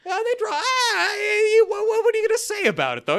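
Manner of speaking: cracking up in disbelief; laughing disbelief. Real, not acted.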